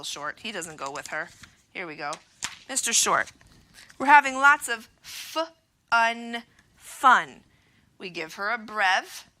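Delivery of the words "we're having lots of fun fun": slow and loud, every word stretched; loud, slow teacher sounding out words; slow emphatic sounding-out. Shown as a demonstration. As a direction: stretch it out for little learners.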